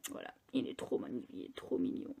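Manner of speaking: whispered, gushing over something cute; cooing whisper. Real, not acted. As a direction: breathe it out like it's adorable.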